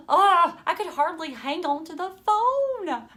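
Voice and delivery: mild nervous fluster. Acted. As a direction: scramble nervously. Nervous on the phone.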